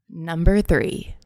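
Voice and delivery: slow, loud sultry announcement; sultry narrator. Acted. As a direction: draw the number out like a sultry host. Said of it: "Did that sound okay?".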